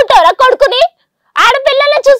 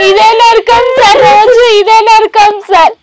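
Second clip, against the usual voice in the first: slow, real sobbing words; crying; 4 dB louder, slower than usual. Real, not acted. Daughter telling the host about daily hell at home, slow crying. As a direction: weep it out slowly.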